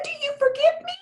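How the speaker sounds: very high, tiny sweet fairy voice; teeny fairy voice. Acted. Fairy asking the doll for sweet potatoes, tiny high voice. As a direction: squeak it out like a polite little fairy. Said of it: "a little teeny voice say".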